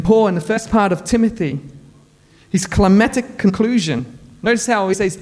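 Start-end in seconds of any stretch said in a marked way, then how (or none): none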